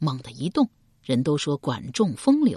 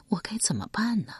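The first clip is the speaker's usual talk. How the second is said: whisper; 5 semitones higher, 5 dB quieter than usual. A narrator's reading. Inner anxious thought.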